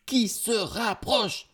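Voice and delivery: high, slow, heightened suspense; very high, slowly intensified warning; drawn-out alarm. A narrator's reading. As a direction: stretch each syllable as danger looms. Narrator repeats, threat much closer.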